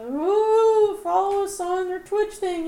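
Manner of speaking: high sing-song ghostly wail; spooky ghost voice, drawn out. Acted. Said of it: "That's the ghost voice that Matt believes in".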